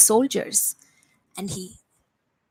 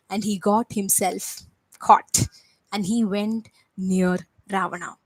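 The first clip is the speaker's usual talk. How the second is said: ordinary delivery, nothing marked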